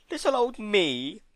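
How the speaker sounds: high, slow mock-hurt; high, slow wounded little voice; wounded innocence. A narrator's reading. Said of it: "in a wounded voice".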